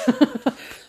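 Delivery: high burst of laughter. Real, not acted. Picturing herself on the prank show, laughing. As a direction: crack up at yourself.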